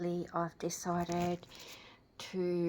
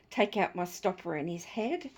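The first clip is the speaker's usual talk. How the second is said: ordinary delivery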